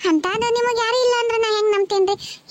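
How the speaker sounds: moved, near tears; tearful pleading. Acted. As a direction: voice catching with tears. Stranger pleads with weeping woman.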